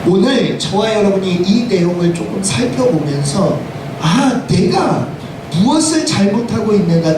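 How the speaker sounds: ordinary talk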